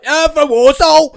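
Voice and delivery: high, loud, slow imitated cheer; mock loud cheer. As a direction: belt it out like your mate did. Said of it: "A minute ago, you're like".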